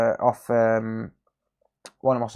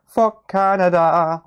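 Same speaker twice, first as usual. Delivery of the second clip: louder, higher and slow, hammed up; South Park song quote; 7 semitones higher, 8 dB louder, slower than usual. Quoting the South Park meme at Canadian chat, hammed-up chant. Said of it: "South Park memes".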